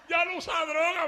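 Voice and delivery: mocking old friend. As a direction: scoff at the new believer. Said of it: "me decía".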